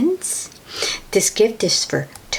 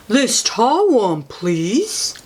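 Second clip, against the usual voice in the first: cow voice; slower than usual. A narrator's reading. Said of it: "says the cow".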